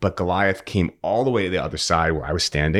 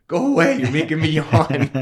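much higher, louder mock horse, laughing; annoyed horse voice; 11 semitones higher, 4 dB louder than usual. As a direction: play the grumpy horse shooing you off. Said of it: "He's like".

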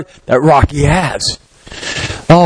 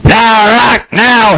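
coach calling; 7 semitones higher, much slower than usual. Retold or imitated. Coach shouting from corner.

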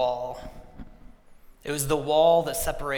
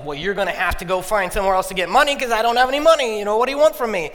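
raised, fast and louder, brushing him off; irritated brushoff; 7 semitones higher, 9 dB louder, faster than usual. Retold or imitated. Telling the neighbor David to find money elsewhere, irritated and loud. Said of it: "And I was like".

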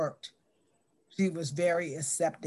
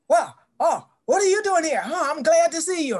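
higher and louder, gushing welcome; delighted greeting; 9 semitones higher, 7 dB louder than usual. Retold or imitated. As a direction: greet an old friend with glee. Eunice greets her, delighted.

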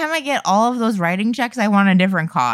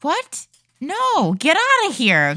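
high, pitched-up what; surprised disbelief; 9 semitones higher, slower than usual. Real, not acted. Player hears the creepy email, surprised and high.

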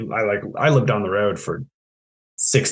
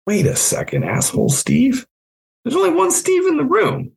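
rising higher into incredulous squeak; mock disbelief. To the fiance who called him asshole Steve, incredulous. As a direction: act stunned at the nickname.

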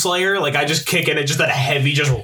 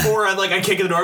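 ordinary delivery

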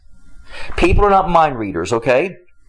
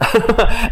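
short laugh; 4 semitones higher than usual. Real, not acted. Laughs after teasing.

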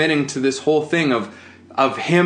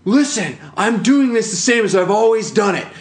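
high, loud mock outburst at his wife; a husband actor blows up at his wife, hammed-up furious yelling; furious retold shout; 9 semitones higher, 4 dB louder than usual. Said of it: "and now he's furious".

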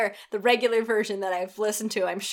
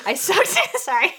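laughing; 9 semitones higher, 7 dB louder than usual. Real, not acted. Laughs mid-read at own slip.

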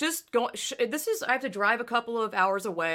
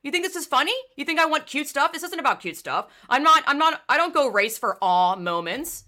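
loud, higher angry tirade at the organizer; furious rant; 7 semitones higher, 5 dB louder than usual. Retold or imitated. She tells off the organizer in her head, furious rant. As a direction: tell him off, seething. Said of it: "I'm like".

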